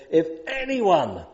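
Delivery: preacher voicing Jesus crying out to the crowd; loud cry. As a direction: call it out over a huge crowd. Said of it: "in a loud voice, cries out this".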